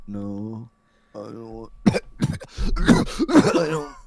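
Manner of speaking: imitating a sick dad, low and faint, then coughing; weak mock refusal breaking into a hammed-up cough; deathly sick dad. Sick dad refusing mom's plea for hospital, feeble and coughing. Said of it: "That man was fucking dead".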